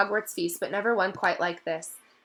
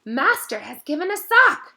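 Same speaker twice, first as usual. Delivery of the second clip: higher, slow, awed elf voice; elf in wonderment; 9 semitones higher, 8 dB louder than usual. A narrator's reading. Dobby marvels that his master gave him a sock. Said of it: "in wonderment".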